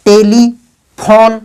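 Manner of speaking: "Telephone" slowly split te-li-phone; slow, divided "telephone"; three syllables. Shown as a demonstration. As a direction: sound out "telephone" in separate beats. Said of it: "यानी तीन syllable".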